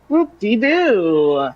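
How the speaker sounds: slow, high sarcastic cheer. Real, not acted. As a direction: fake enthusiasm, drawn out. Dreading more voice lines.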